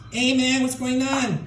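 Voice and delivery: high-pitched, hammed-up Mexican accent; dad's Mexican accent. Comic walking around talking like dad, Mexican accent. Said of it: "talking like my dad".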